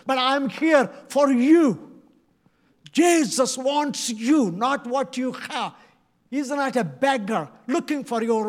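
loud, earnest pleading sermon; preaching at full force. Acted. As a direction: plead with the crowd, hammering each line. Impassioned appeal to congregation.

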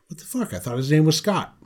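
quick, high mock-baffled outburst; retold bafflement. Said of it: "and was like".

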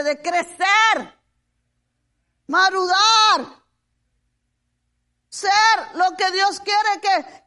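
forceful preaching, key words drawn out; emphatic sermon delivery with long, loud word endings. Real, not acted. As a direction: proclaim it slowly and firmly to the congregation. Preaches to congregation.

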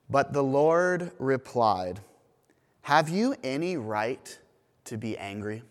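measured, formal Bible reading to the congregation; scripture reading. Acted. Pastor reads Jonah to the congregation, formal scripture reading. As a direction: read it out as the lesson.

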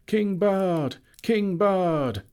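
high, loud cheering chant; crowd shouting. A narrator's reading. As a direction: roar it like a jubilant crowd. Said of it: "they shouted".

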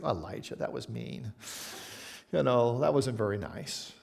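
tut-tut at Elijah under the breath; mock scolding. A prim listener scolds Elijah, low and soft.

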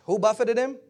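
normal talking voice

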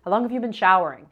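loud, fast nagging demand; whiny ex impression. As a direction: snap it out, irritated and quick. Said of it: "I hear my ex's voice".

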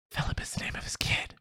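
excited gasped whisper. Real, not acted. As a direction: whisper the discovery. Sudden realization, whispered.

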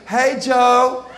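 playing a flirty temptress calling Joe; flirty wife. Potiphar's wife flirting with Joseph, mock seductive. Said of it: "She's like".

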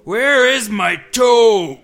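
high, slow, drawn-out monster shout; groaning monster. A narrator's reading. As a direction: wail it slowly like a ghoul calling out. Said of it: "it groaned".

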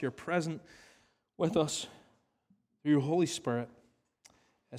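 hushed pastoral prayer. Acted. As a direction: pray quietly on behalf of the room. Leads closing prayer.

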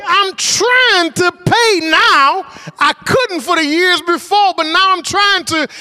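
exasperated plea. Retold or imitated. Preacher retelling his plea to the collector, exasperated. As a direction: plead in frustration. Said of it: "I'm like".

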